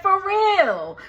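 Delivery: incredulous. Retold or imitated. Reacting in disbelief to gossip.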